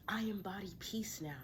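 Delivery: ordinary talk, nothing marked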